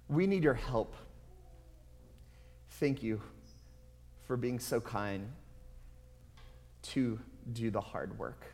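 earnest pleading prayer; heartfelt sermon-closing prayer. Real, not acted. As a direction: pray honestly for help, intimate.

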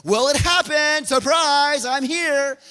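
loud, high-pitched surprise reveal; hammed-up excited greeting; mock cheery announcement. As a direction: shout it like a big ta-da. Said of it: "Jesus had to be sitting there saying".

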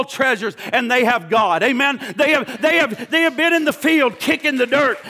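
loud, driving preacher's cadence; full-force preaching. Acted. Preacher to congregation about the treasure, full-force preaching.

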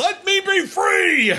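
high, drawn-out mock shout; hammed-up liberation cry. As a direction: stretch each word out in a theatrical yell. Acting out Brad Pitt's movie outburst.

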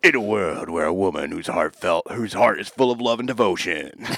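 hammed-up movie trailer narrator. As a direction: boom it out like a film trailer. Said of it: "This sounds like a movie trailer".

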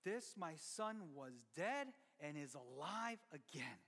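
a hint of a tender father, slow. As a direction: gently welcome the son home. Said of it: "the dad tells his son".

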